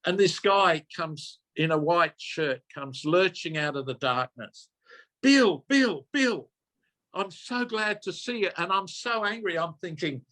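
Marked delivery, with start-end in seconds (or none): 5.2-8.5 imitating desperate stranger. Stranger lurching out at night, calling desperately. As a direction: mimic a distraught man calling out in the dark.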